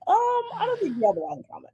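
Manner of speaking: fast, high, snarky comeback; sarcastic retort. Retold or imitated. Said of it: "I'm like".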